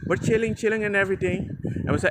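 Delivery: ordinary talk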